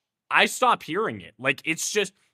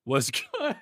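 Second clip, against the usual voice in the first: breaking into laughter mid-phrase; laughing greeting. Acted. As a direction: try to say it, crack up. Laughs while mimicking the greeting.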